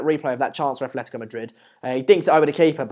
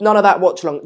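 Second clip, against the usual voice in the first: ordinary delivery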